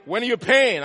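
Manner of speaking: loud, pushy demand for payment; demanding school voice. Retold or imitated. School office demanding its fee, loud and pushy. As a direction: press him hard for the money.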